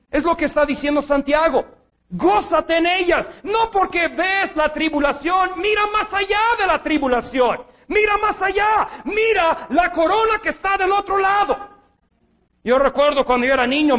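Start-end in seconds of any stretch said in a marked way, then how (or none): 2.1-11.6 preaching at full force. Acted. Preacher urging the congregation to look beyond tribulation, fervent shout. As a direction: lift them past the trial with fire.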